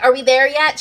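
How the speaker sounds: put-on whiny child. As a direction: nag like a kid on a long car trip. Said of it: "you're like".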